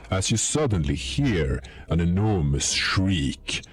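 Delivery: ordinary delivery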